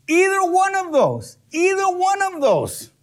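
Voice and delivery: an insistent preacher repeating himself; high emphatic repeat. Real, not acted. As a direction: drive the point home. Emphatic repetition.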